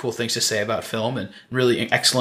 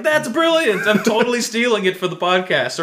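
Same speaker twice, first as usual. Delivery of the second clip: excited; 8 semitones higher than usual. Retold or imitated. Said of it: "I was like".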